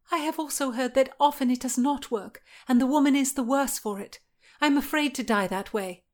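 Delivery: high, worried woman's voice; fearful Anne. Acted. Anne dreads the midwife's methods, fearful.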